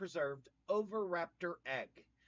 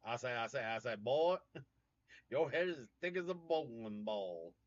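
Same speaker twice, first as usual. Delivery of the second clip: put-on mild Southern accent, blustering like Foghorn Leghorn; 2 semitones lower than usual. Streamer mocks his own Southern roots in a mild Southern accent. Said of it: "Sound like Foghorn Leghorn".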